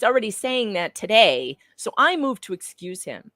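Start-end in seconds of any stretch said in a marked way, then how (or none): none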